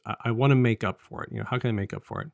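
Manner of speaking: normal talking voice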